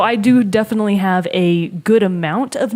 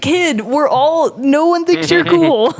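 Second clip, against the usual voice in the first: slow, higher-pitched put-down; mock exasperation; 7 semitones higher, 3 dB louder, slower than usual. Player answering an edgy twelve-year-old, mock-exasperated put-down. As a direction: drag it out, higher, to deflate a show-off. Acted retort to edgy kid.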